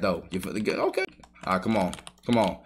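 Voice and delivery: normal talking voice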